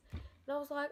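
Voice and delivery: ordinary delivery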